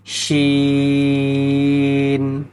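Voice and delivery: শিন stretched over four alifs; long drawn sound. Shown as a demonstration. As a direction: hold the vowel of শিন long and steady. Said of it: "শিন, চার আলিফ পরিমাণ টান হবে". (শিন শব্দটি চার আলিফ লম্বা টেনে)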